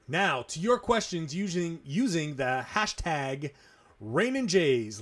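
ordinary talk

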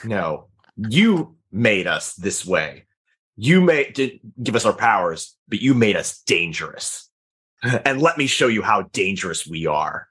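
deep hero voice. Acted. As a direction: growl it back at the villain. Hero answers villain, deep.

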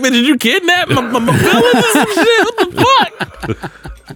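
high laughing complaint fading to breathy; hurt feelings played for laughs; laughing mock victim. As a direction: whine it out, then collapse into laughter. Imitating kidnap victim, laughing.

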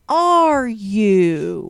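blunt translation of the polite question; plain meaning said flat. Shown as a demonstration. Translating the old lady's code, blunt. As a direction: drop the sweetness, say it bluntly.

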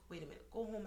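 whispered imitation of a friend; very soft, breath-only urging; retold hushed advice. Best friend telling her to leave work, hushed advice.

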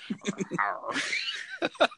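high, wild clown cackle; Doink the Clown laugh. Retold or imitated. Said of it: "I love this Doink the Clown impression".

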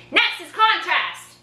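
slow, high, attention-grabbing yell. Real, not acted. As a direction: call it out to wake the class. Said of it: "So I'm yelling at you".

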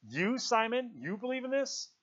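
high-pitched, incredulous question; amazed. A narrator's reading. Ralph stands up to question Simon, amazed. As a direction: question him in rising disbelief. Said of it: "Ralph stood up in amazement".

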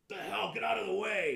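loud, high annoyed yell; angry shout. Acted. GM voicing the guard yelling at his comrade, angry shout. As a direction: shove a comrade aside, yelling.

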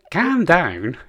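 slow, high, loud puppet whine; Zippy from Rainbow voice. Retold or imitated. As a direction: drag it out like a children's TV puppet. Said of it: "a stupid zippy from fucking Rainbow Voice".

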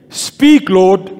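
normal talking voice

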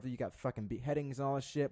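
usual speaking voice

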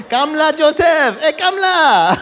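high, loud Filipino-Malaysian accent, played for laughs; hammed-up Filipino accent. Potiphar's wife calling Joseph to come, hammed-up Filipino accent. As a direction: call him over in a broad mock Filipino accent. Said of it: "my best Filipino accent".

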